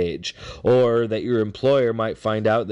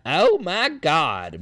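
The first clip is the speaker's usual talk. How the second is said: replayed high, slow Southern exclamation; high-pitched Southern woman impression; nasal Southern accent; 7 semitones higher, much slower than usual. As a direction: mimic her nasal Southern twang, drawn out. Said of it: "exclaiming in a nasal southern accent".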